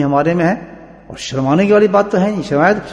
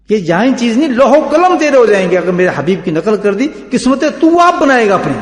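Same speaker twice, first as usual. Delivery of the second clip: raised, forceful sermon voice; fervent preaching; 6 semitones higher, 5 dB louder than usual. Acted. Preacher voicing God's promise to the believer, fervent.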